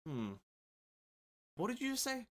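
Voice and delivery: high, puzzled asking back; mock bewildered question; confused "what?". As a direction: squint at a sentence you didn't catch. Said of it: "you have to be there like".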